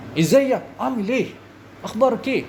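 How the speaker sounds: high, slow, punched Egyptian dialect; rough Egyptian Arabic. Shown as a demonstration. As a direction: mimic coarse Egyptian speech.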